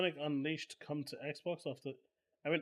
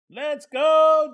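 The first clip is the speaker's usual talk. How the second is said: excited shout; 11 semitones higher, 16 dB louder than usual. Real, not acted. Cheering as poll result lands.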